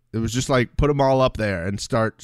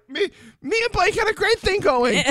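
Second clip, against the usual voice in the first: mimicking James. Acting out James again.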